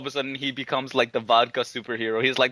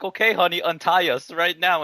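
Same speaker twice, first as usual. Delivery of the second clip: tag; 5 semitones higher, 4 dB louder than usual. Acted. Acted wife ordering husband.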